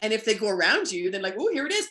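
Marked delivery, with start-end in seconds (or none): 1.4-1.9 high mock surprise; mock-surprised reveal to the dog; playful peekaboo. Shown as a demonstration. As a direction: tease like playing peekaboo.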